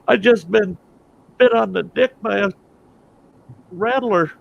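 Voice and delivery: high, strained cry of the injured; pained wail. Acted. Bitten Lone Ranger tells Tonto what happened, high pained wail. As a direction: wail it in hammed-up agony.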